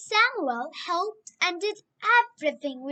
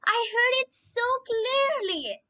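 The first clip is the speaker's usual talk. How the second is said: put-on boy Samuel insisting to old Eli, eager; insistent boy; 8 semitones higher than usual. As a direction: press the point, pleading.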